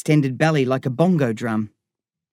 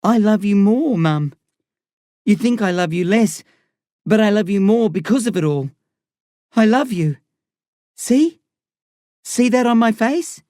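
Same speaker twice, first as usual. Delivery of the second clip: higher, earnest and emotional; tearful pleading; 6 semitones higher than usual. A narrator's reading. Crying son reassuring his jailed mum, pleading. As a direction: plead your love to her, voice rising.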